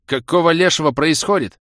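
loud, high alarmed cry; alarmed outburst. A narrator's reading. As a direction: demand it in rising panic. Alarmed hero demands answers.

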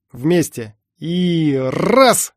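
loud, effortful count that rises; heave count. A narrator's reading. Two men straining at the wheel, shouted heave. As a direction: coordinate a joint pull.

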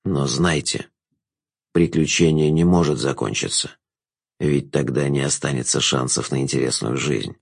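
low, soft, ominous voice; low villain, hushed and grave; warning Jester. A narrator's reading. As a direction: warn her quietly in a low pitch. Jester warns the girl.

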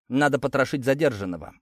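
usual speaking voice

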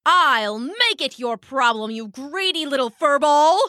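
angry bird threat, rising in pitch; enraged dove. Acted. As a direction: threaten the mouse with outraged indignation.

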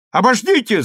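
high, urgent cry to halt; shouted interruption. A narrator's reading. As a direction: cry out to stop them. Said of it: "закричал Александр".

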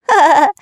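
loud high giggle; giggling. Acted. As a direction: cackle with glee. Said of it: "захихикал Дырка, трясясь от смеха".

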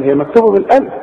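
ordinary talk, nothing marked

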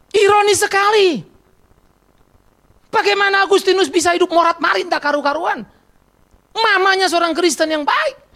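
high, loud sermon delivery; impassioned preaching. Acted. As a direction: press each point on the congregation. Preaches at full force. (berkhotbah dengan nada tinggi dan lantang)

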